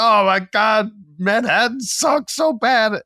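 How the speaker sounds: very high, slow whiny wail; hammed-up despairing whine; mock whining. Telling driver he hates Manhattan, high mock whine. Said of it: "And I was like".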